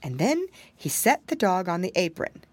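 plain reading voice

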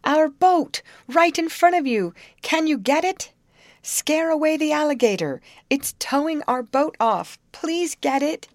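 high, loud appeal across the river; calling out. A narrator's reading. Molly begging the youth to save their boat, loud calling. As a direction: shout for help over the water. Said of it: "she called".

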